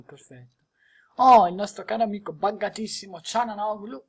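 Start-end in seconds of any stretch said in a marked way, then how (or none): none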